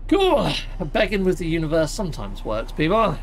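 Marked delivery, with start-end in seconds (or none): 0.1-0.5 high, loud burst of delight; triumphant cheer. Real, not acted. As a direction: cheer it as the landing works. The ship finally landed.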